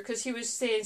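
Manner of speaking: normal talking voice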